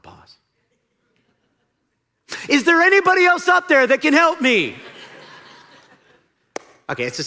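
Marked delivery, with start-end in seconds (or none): 2.5-4.8 desperate shout. Acted. Jack's punchline plea.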